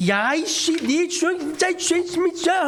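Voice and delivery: high, exasperated cursing; indignant outburst. Acted. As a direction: sputter in offended disbelief. Acted offended outburst at Jesus.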